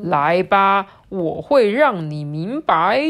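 loud, drawn-out elephant threat; challenging elephant. Acted. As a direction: beckon boldly, stretching out the last words. (大象拖长音大声挑战)

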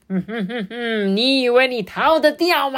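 mock the fleeing worm; taunting toad. Toad taunting the fleeing Ajun, gloating chuckle.

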